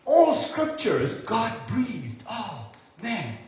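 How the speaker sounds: slow, high swell dropping into "oh, man"; slow awestruck declaration; awed emphasis. Real, not acted. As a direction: proclaim it slowly, marvelling. Preacher marvels at a verse.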